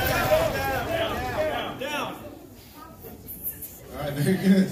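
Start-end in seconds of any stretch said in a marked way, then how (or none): 0.1-2.2 shouting. Acted. Pastor reliving the drill, shouting at the congregation to get down. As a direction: shout it high to drop the room.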